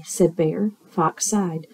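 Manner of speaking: ordinary delivery, nothing marked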